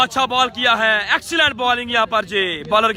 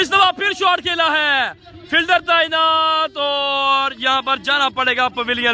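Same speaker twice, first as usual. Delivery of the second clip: excited cricket commentary; 3 semitones higher, slower than usual. Real, not acted. Live cricket shot call.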